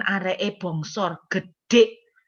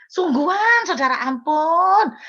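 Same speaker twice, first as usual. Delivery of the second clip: exasperated outburst; 7 semitones higher, 5 dB louder, slower than usual. Real, not acted. Exasperated cry to the congregation.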